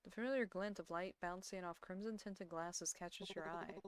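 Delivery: ordinary talk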